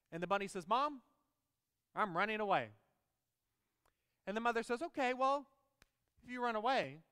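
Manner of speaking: lively storybook voicing of bunny and mother; reading to children. Preacher reading a children's book to the congregation, animated. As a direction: tell it like a bedtime story to kids.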